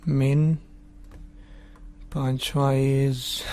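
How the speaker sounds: ordinary talk, nothing marked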